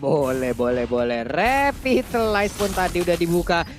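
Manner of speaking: ordinary talk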